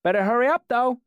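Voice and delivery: put-on a little boy shouting a cheeky warning; young boy calling. A narrator's reading. Small boy calls out teasingly.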